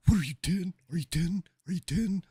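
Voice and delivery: loud, high, needling repeat; hammed-up yelling mimic of a heckler; mocking the heckler. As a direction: badger him like a schoolyard taunt. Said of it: "repeating himself over and over".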